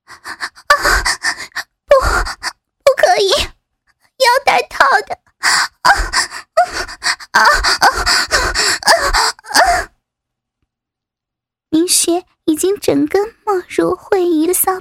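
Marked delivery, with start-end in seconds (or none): 0.0-9.9 higher, gasping protest; crying out. A narrator's reading. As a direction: cry out between heavy breaths. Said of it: "慧仪叫了出来".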